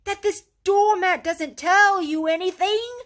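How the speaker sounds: high, loud, word-by-word cry; can't believe he doesn't get it; excited. A narrator's reading. Rat presses Mole about the doormat, excited. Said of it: "cried the excited rat".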